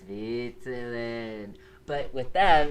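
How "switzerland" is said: slow growled dog voice. Retold or imitated. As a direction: growl it like a hellhound. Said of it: "it's a really low growl, like, dog voice".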